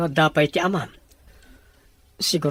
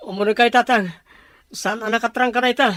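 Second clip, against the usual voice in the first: alarmed calling; 6 semitones higher, 8 dB louder than usual. Acted.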